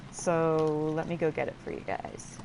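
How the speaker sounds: ordinary talk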